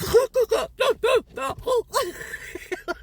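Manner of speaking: high, cracking cry-laugh; panicked shriek-laugh. Retold or imitated. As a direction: squeal like a startled child. Granddaughter panics under the mask.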